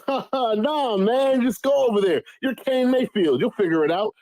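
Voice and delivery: a touch of a carefree buddy brushing it off; breezy friend. Retold or imitated. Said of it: "He's like".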